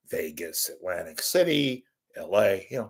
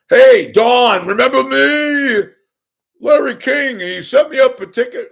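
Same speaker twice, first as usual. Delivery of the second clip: very high, very loud mock holler; hammed-up yelling; 13 dB louder than usual. Fan joking about pestering Rickles, mock yelling. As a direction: bellow it like a pestering fan, stretched out. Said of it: "There's no yelling".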